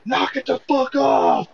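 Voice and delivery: high, slow shout. Retold or imitated. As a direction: shout at the fireworks. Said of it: "and I was like".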